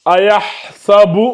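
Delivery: Ayahsabu récité lentement, syllabe par syllabe; récitation lente. Shown as a demonstration. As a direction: lire Ayahsabu en détachant chaque syllabe. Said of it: "Le Coran nous dit". (Ayahsabu psalmodié lentement)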